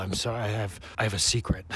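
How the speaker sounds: low, soft reluctant confession; lightly put-on brooding confession, kept low; mock confession. Owning up to a secret, mock-solemn and low.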